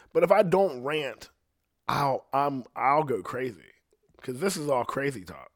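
normal talking voice

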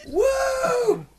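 high, loud "woo" cheer; mock reveler's high-pitched whoop; party whoop. Imitating bachelorette party cheer.

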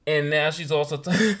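usual speaking voice

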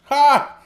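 high, explosive one-syllable laugh; single loud "Ha!". Real, not acted. Co-host reacting to a pun with a loud bark of laughter. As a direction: burst out one sharp, loud "Ha!".

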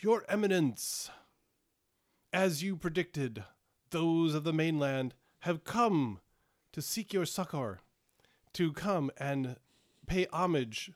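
a courtly seneschal presenting visitors; seneschal's voice. Acted.

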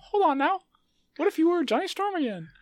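very high squeaky cartoon mouse; Mickey Mouse voice. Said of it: "Mickey Mouse Voice".

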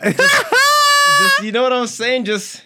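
excited yelling. Real, not acted. Friend bursts out yelling.

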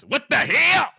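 loud, high outraged shout; mock-incredulous bellow. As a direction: yell it in hammed-up disbelief.

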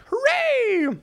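high, drawn-out hooray; mock cheer. Player mock-celebrating a return to Silver Gulch, hammed-up cheer.

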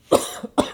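wet choking cough; coughing up water. Real, not acted. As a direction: cough hard on swallowed water. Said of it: "Water went down the wrong pipe".

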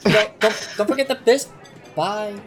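high, loud calling after someone; flustered cook. Acted. Cook calls after a customer walking off, high and flustered. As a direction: squeak out a reminder that peters into goodbye.

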